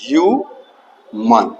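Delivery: "ह्यूमन" drawn out as ह्यू, pause, मन; teacher sounding out "ह्यूमन" in pieces; syllable-by-syllable pronunciation. Shown as a demonstration. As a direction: say "ह्यूमन" slowly in two separate parts. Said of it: "अब इसका उच्चारण होगा".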